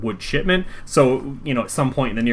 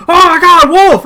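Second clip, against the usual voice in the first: high loud cry; alarmed shout; 13 dB louder than usual. Acted. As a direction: scream Wolf's name in alarm. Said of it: "they're like".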